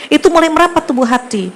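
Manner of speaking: ordinary talk, nothing marked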